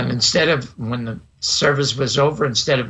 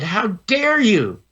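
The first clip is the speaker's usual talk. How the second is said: high, slow retold indignation; outraged minister; 7 semitones higher, slower than usual. Minister rebukes the boy before a hushed church, high drawn-out indignation. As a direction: draw it out as a scandalized scolding.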